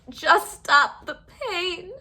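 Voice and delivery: high, slow whimper with a sob. A narrator's reading. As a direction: whimper it through tears. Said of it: "whimpered the fox".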